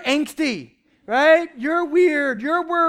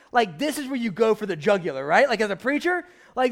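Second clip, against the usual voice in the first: usual speaking voice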